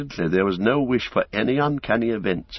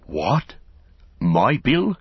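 mild astonished exclamation, rising; surprised cry; 3 semitones higher, much slower than usual. A narrator's reading. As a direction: ask it in delighted disbelief. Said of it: "cried Sam".

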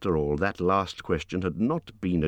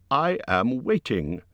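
higher, forced cheerful Bilbo voice; trying to sound bold; 6 semitones higher, slower than usual. A narrator's reading. Bilbo bluffing Gollum at the riddle game, forced cheer. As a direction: put on a brave, cheery front. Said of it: "He tried to sound bold and cheerful".

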